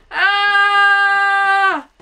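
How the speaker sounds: high loud yelp of fright; startled scream. Real, not acted. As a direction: shriek at the jump scare. Sudden fright in game.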